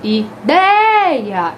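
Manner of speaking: "ideia" loud, with DEI held long; exaggerated penultimate stress. Shown as a demonstration. Teacher reads out another example, loud stretched DEI in "ideia". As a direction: swell on DEI in "ideia" and let the A fall. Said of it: "quando eu vou ler a palavra".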